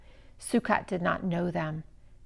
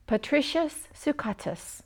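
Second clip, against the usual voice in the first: proudly; 6 semitones higher, 4 dB quieter than usual. Acted. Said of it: "said the boy proudly".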